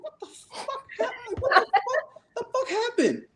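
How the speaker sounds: very high, squeaky retold bewilderment; high-pitched comic "what on earth"; incredulous disbelief. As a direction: act out total bafflement in a falsetto. Baffled at fleeing barefoot friend.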